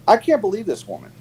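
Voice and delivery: usual speaking voice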